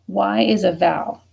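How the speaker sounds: normal talking voice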